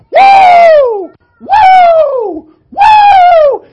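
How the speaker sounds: long high whoops like a train whistle, very loud; train-whistle woo. Retold or imitated. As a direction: hoot like a steam train. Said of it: "he looked like a train".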